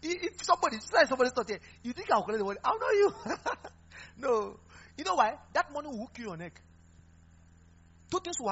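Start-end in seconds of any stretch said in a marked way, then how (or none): 2.6-3.3 high and loud through a laugh. Real, not acted. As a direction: toss it out laughing. Preacher laughing at own joke.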